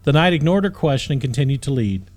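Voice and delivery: plain reading voice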